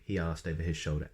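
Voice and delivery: ordinary delivery, nothing marked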